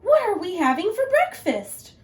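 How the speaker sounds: high, loud cheerful granny. Acted. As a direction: innocent old lady asking. Granny voiced.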